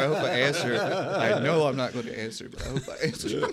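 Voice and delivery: cracking up while acting hopeful; laughing impression. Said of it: "He's like".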